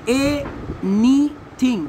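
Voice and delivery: "एनीथिंग" very slowly, in three separate beats; long pauses between the syllables of "एनीथिंग"; split into syllables. Shown as a demonstration. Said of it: "इसको cutting करना है".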